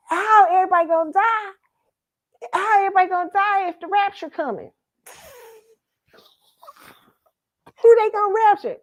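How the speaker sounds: put-on high little girl questioning; child voice. Little girl arguing with grandpa about the rapture, high. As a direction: reason it out like a small kid. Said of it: "I said".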